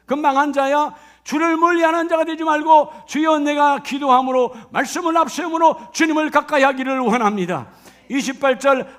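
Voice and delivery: loud, driving sermon voice; impassioned pulpit delivery; fervent preaching. Acted. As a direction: preach it out to the congregation.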